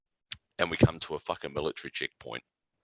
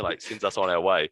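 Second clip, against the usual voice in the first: usual speaking voice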